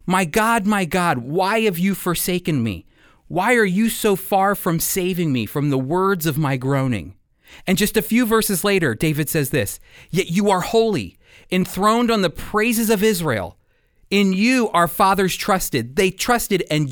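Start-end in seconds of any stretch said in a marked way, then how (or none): none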